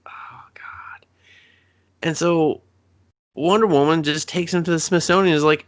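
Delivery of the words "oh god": breathy whisper of disbelief; whispered dismay. Retold or imitated. Recapping the movie, whispers her stunned reaction. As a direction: barely voice it, appalled.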